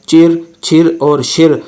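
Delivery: चिर, छिर और शिर with curled-back retroflex r, slow; teacher models चिर, छिर, शिर slowly with tongue curled back; retroflex chir chhir shir. Shown as a demonstration. Teacher drilling students: slow retroflex syllables. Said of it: "Z H के साथ जो आई है".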